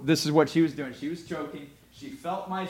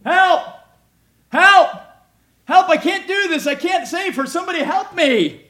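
very high, loud frantic cry for help; panicked scream for help; 15 dB louder than usual. Retold or imitated. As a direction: shriek it like a terrified friend in a restaurant. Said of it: "What did she start doing".

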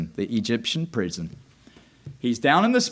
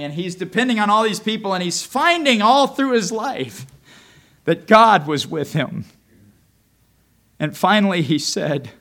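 usual speaking voice

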